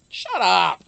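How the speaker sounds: loud mock "shut up"; playful shout. Real, not acted. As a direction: yell it in fake outrage.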